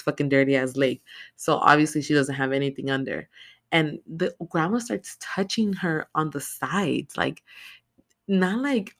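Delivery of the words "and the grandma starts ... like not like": ordinary delivery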